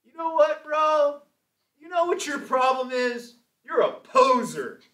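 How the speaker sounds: high, loud surfer-dude impression; slow, drawn-out mock confrontation in a surfer voice; Dennis. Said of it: "this is how he talks".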